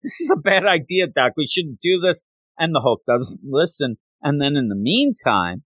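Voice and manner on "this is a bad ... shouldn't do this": quick, higher-pitched mock yell without real volume; retold yell. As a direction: imitate an urgent yelled warning, lightly. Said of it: "Rick Jones is yelling".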